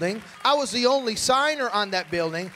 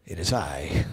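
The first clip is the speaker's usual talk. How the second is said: much lower, booming self-important "Yes... It is I"; deep reverend voice; 9 dB quieter, slower than usual. Retold or imitated. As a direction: drop into a grand deep pastor voice. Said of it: "my voice changes".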